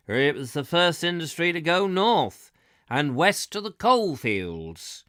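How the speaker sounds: put-on southern country accent, slowing at the end; fake Southern accent. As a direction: guide explaining the iron trade, fake Southern accent. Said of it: "I was told in this fake South, um, accent".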